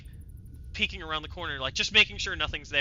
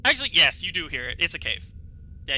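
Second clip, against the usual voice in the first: usual speaking voice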